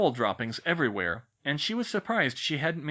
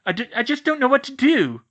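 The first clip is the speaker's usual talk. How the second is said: distraught; 12 semitones higher, 9 dB louder than usual. A narrator's reading. Cho distraught after shouting.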